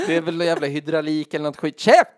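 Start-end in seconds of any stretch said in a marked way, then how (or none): 1.8-2.1 sharp high yell of shut up; shout. Real, not acted. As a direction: bark it out loud and high. (högt, gällt rop)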